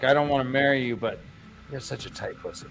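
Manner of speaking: low, hushed lover's confession; quiet mock-romantic quote. Lan confessing to the woman he loves, hushed and low. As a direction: drop low and trail into near silence. Acts out Lan talking to his love.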